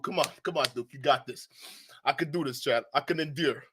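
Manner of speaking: ordinary talk, nothing marked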